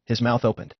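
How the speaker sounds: ordinary delivery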